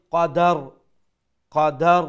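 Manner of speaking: কদার with a vowel put on dal; wrong, extra vowel. Shown as a demonstration. Teacher shows mistake, vowel added. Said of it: "জজমর জায়গাত হরকত পড়ে দিলাম".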